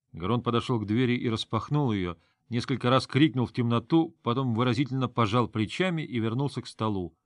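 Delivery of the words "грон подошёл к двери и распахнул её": ordinary delivery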